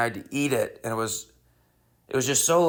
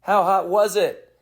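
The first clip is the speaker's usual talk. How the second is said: crowd call-back; 7 semitones higher, 4 dB louder than usual. Retold or imitated. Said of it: "people will go".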